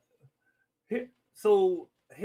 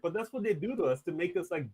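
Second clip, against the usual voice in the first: usual speaking voice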